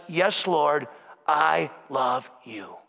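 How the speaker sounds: tender confession. Acted. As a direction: say it like a humble confession of love.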